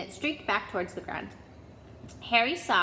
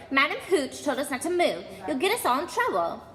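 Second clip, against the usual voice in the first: higher, bossy warning; scolding; 5 semitones higher, 4 dB louder than usual. A narrator's reading. Bossy classmate warning Harry, higher scolding voice. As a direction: warn him off, prim and urgent.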